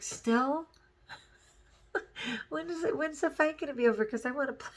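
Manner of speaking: put-on high, fast, laughing kid voice; little kid. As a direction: chirp it like an eager little kid. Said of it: "And I'd be like".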